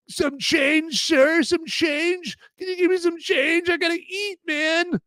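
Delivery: high, slow pleading beggar. Pretend. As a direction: whine for spare change like a panhandler. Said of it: "shaking my tin coffee cup".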